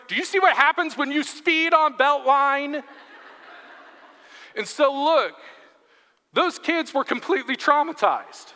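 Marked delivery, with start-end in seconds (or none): 0.1-2.8 high, mock-stern parental holler; hammed-up dad driving the warning home; lecturing dad. Dad warning his teens about speeding, mock-stern holler. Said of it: "he points at the S U Vs".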